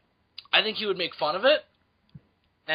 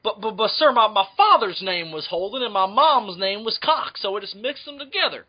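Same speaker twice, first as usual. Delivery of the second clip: put-on southern accent; 2 semitones higher than usual. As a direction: stammer into a hammy Southern explanation. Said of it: "I'm gonna put on my southern accent".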